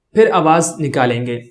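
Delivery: ordinary talk, nothing marked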